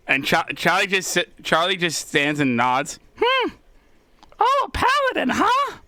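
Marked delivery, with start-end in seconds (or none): 3.2-5.8 high squeaky kobold. Acted. GM voices kobold Charlie.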